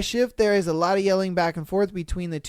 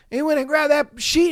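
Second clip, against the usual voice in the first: ordinary talk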